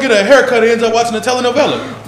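high, loud disbelief; who does that, loudly baffled; incredulous. Real, not acted. Incredulous at barber story.

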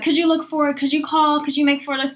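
high, rapid-fire imitated boss demands; mock a boss talking down to an assistant; pestering, condescending mimicry; nasty hounding. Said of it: "hounding me in my ear with this nasty attitude".

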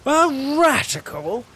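shocked, offended echo; outraged disbelief. Acted. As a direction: repeat the insult in disbelief. Narrator outraged by the word.

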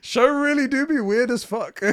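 jokey high meme delivery. Acted. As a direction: stretch it out in a goofy high voice. Reading meme phrasing.

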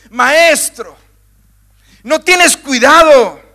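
high, loud accusing question; reproachful plea. Acted. Preacher demonstrating Mark's disciples, reproachful and loud. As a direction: press him with blame, words slowed. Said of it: "tono de reproche".

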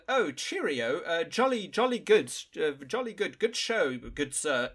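imitating a posh British officer; posh Queen's English. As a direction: ham up a jolly-good English toff. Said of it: "extremely high Queen's English accent".

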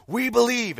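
loud, high crowd cheer; imitating a crowd's roar. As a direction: shout it as a whole crowd. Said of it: "the crowd roared".

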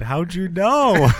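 drawn-out high shocked how'd you know; mock surprise. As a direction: stretch it out, high and loud.

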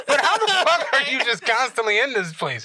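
high, amused disbelief; baffled question with a laugh in it; mock incredulous. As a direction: repeat it in exaggerated amazement. Repeats acted disbelief at friend.

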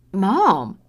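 loud sharp cry of protest; yelled. Acted. Daughter yelling at her mother to stop. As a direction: yell to make her stop. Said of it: "Samantha yelled".

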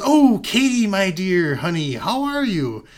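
high, loud, gushing hello; hammed-up delighted welcome; effusive greeting. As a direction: greet her like a long-lost darling, loud and high. Acting Mister Cleary's effusive greeting.

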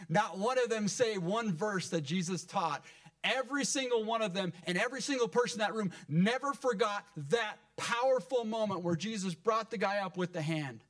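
high, impassioned preacher's delivery; fired-up preaching. Real, not acted. Preacher telling church of Jesus confronting his accusers, fired-up preaching.

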